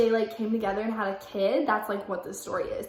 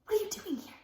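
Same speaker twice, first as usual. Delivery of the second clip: urgent, high-pitched whispered shout; forceful stage whisper; whisper yell; 9 semitones higher, 5 dB quieter, faster than usual. Acted. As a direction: yell it under your breath. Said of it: "I whisper yelled".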